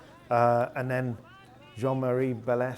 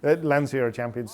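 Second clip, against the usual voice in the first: dismissive shrug; 9 dB louder than usual. Retold or imitated. Italian authorities dismissing, retold.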